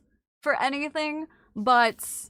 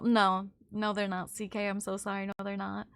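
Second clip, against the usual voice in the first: low, very soft admission; sheepish aside; 6 semitones lower, 8 dB quieter than usual. Real, not acted. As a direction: back down under your breath. Backing down about food takes.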